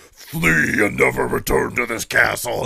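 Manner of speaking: slow booming dragon command. Acted. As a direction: order them off like a dragon. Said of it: "You, you just hear me going".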